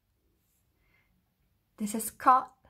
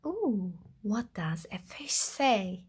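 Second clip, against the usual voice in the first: plain reading voice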